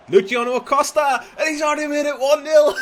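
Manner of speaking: screaming goal celebration; excited goal shout. Real, not acted. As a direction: roar the goal call. Goal scored, shouting commentary.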